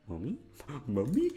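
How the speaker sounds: ordinary talk, nothing marked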